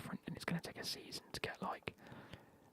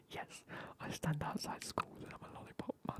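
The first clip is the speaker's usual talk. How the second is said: usual speaking voice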